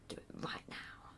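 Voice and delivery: very soft whispered aside. Real, not acted. Streamer deciding whether to do it now, whispering. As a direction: drop right down to a whisper.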